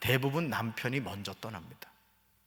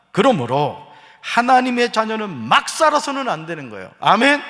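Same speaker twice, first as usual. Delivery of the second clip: much higher, louder, rising sermon climax; full-force preaching; 9 semitones higher, 9 dB louder than usual. Acted. Pastor driving the point home to the congregation, full-force preaching. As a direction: preach it out over the music.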